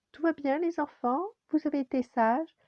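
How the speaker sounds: high, sweet-talking mother voice; high-pitched mum checking in; mother crab. A narrator's reading. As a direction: ask the little ones in a high lilt. Mother crab wakes, checks on children.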